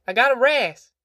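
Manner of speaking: put-on high, whiny little girl; whining child. A narrator's reading. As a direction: whine it like a worn-out child. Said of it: "she whined".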